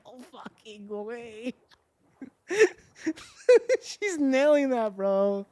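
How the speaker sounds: breathless amazed giggle; giggly disbelief. Real, not acted. Reacting to chat yodeling.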